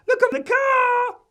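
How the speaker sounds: put-on high, loud small child's call; piercing kid's holler. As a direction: yell it out like an excited little boy. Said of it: "called out".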